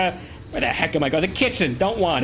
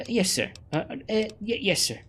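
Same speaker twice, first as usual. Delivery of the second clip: meek stammer; 4 semitones higher, 5 dB quieter than usual. Acted. Cowed reply to scolding Philippe.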